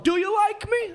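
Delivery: very high, eager plea; hammed-up neediness; mock needy comedian. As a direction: beg for approval in a squeaky voice. Voicing comedians begging for approval.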